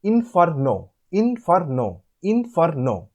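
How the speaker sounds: "inferno" in three distinct beats, falling at the end; three separate syllables. Shown as a demonstration. Teacher demonstrating three syllables, "inferno" broken into in-fer-no. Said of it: "there are three syllables".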